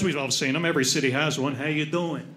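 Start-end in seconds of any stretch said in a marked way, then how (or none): 1.5-2.2 lightly voiced lower Italian-American guy; a hint of neighborhood wise guy, pitched down and softer. One of those three guys greeting you, lightly voiced Italian tough. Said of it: "You ever see those three guys?".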